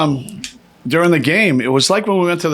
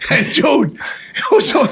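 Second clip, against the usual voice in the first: laughing; 12 semitones higher than usual. Real, not acted. Laughing while telling.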